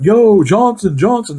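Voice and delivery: yelling a name. Retold or imitated. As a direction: call out his name to tease him. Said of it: "They were yelling at him".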